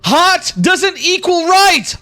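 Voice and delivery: high, slow chanted yell; protester yell. Acted. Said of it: "Another person's yelling and saying".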